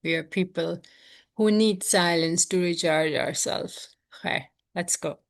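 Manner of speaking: normal talking voice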